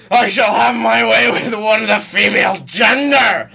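God's roar. Acted. Co-host showing how God would deliver it, roaring. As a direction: bellow it like an almighty proclamation. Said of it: "Well, he roars it".

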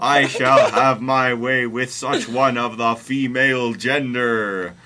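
loud, slow booming proclamation; God roaring. A narrator's reading. Host reading God appearing to Mary, roaring. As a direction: roar it out grandly, word by word. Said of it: "roared God".

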